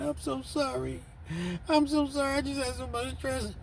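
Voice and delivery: high, put-on woman's voice, sorry; pleading wife. Wife apologizing to husband in a high pleading voice. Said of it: "She's crying".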